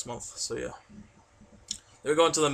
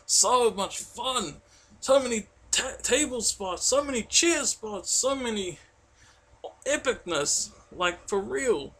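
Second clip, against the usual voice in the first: much higher, gushing excitement; excited gush; 8 semitones higher, slower than usual. Real, not acted. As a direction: gush about the match, pitched way up. Gushing over favourite match.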